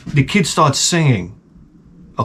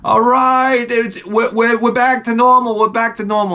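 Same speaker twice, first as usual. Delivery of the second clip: high, gleeful mock cheer; imitating cheering people; 11 semitones higher than usual. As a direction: cheer like a celebrating crowd. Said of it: "people are cheering".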